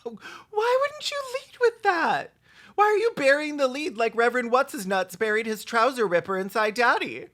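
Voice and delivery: ordinary talk, nothing marked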